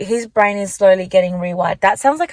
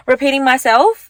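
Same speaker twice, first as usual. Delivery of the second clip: "repeating myself" said through a light laugh, ending on a rise; 5 semitones higher, 5 dB louder than usual. Real, not acted. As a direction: laugh at yourself while saying "repeating myself".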